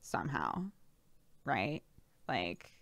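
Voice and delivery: low, quiet fried creak; vocal fry. Shown as a demonstration. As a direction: let the vocal cords crackle as if fried. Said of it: "your vocal cords are fried".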